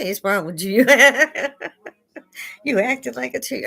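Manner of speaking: high, laughing mock reprimand; mock scolding through laughter. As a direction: scold him while cracking up. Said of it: "I'm like".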